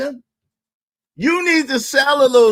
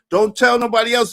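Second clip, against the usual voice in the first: ordinary delivery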